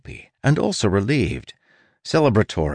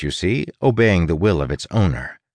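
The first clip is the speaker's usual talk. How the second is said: ordinary delivery, nothing marked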